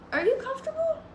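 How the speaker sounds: very high, sing-song check-in; high-pitched cooing aside. Real, not acted. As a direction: coo the question way up high. Aside to someone beside her.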